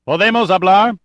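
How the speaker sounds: high, loud call in Spanish; shout. A narrator's reading. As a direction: shout the question across to the enemy. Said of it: "he shouted".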